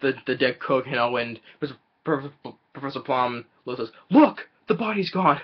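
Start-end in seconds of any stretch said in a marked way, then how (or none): 4.1-5.4 higher, louder startled cry; mock alarmed. Professor Plum discovering the body is gone, mock alarm. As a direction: gasp it out in alarm.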